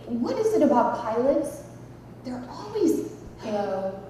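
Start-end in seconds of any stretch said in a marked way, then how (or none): 3.4-4.0 low, soft, smooth announcer hello; airline pilot voice. Acted. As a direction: greet the cabin like a trained pilot. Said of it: "sound like they've been to voice school".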